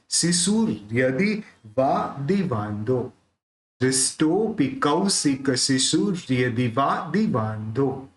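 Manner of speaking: careful syllabic recital; slow pronunciation demo. Shown as a demonstration. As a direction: say शिशुर with the र closing शुर, slowly. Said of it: "उसको अपन सु के साथ add करेंगे".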